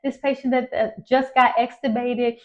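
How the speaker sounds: high, pressing plea for help; begging a passing nurse to come now; retold high anxious pleading to a nurse; urgent plea. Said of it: "I said".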